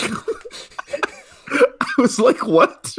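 high, laughing disbelief; cracking up. Real, not acted. Viewer recalling the bubble gum scene, cracking up. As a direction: barely get it out through laughter.

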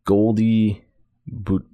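normal talking voice

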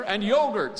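high, loud triumphant add-on. Acted. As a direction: toss it in proudly. Dave adding triumphantly.

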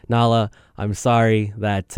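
ordinary talk, nothing marked

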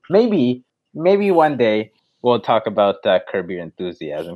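usual speaking voice

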